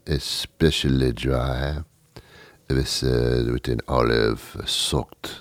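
put-on low, soft, slow French accent; hushed, hammed-up French seducer voice; phony French accent. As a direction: order suavely in a fake French accent, drawing it out. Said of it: "you do it with a French accent".

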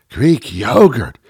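slow, hammed-up reverence; mock awe. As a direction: draw out "Greek yogurt" as something grand. Mocking the reverence for it.

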